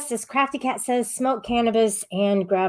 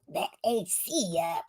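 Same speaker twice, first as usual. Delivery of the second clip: much softer, sullen mumbled aside; lazy grumbling imitation of a rude chatter; mock mumble; 3 semitones lower, 10 dB quieter than usual. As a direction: toss it off like a surly kid under your breath. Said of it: "that's exactly how you would say it too".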